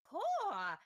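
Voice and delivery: high loud caw; loud raven call. Acted. GM voices the raven.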